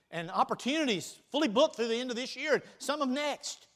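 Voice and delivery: usual speaking voice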